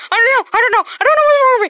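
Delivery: high, loud gleeful yell; high manic yell; giddy shout. Pretend. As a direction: shout it with wild glee. Mickey toy shouts giddily.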